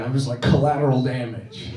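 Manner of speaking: ordinary delivery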